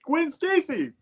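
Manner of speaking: high-pitched mock-amazed announcement; playful big reveal; excited reveal. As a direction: announce the surprise guest in a high voice. Mock-excited announcement of surprise character.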